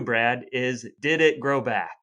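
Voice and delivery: ordinary talk, nothing marked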